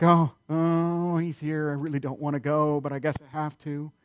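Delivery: sighing, put-upon acting; hammed-up weary reluctance, trailing off softly; mock reluctant. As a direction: grudgingly give in, dragging out the "oh". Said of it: "She doesn't come reluctantly".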